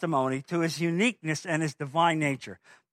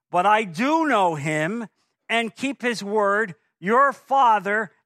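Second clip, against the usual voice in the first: forceful scripture reading; 6 semitones higher, 5 dB louder, slower than usual. Acted. Reading Jesus' words with force.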